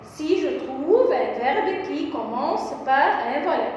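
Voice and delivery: ordinary talk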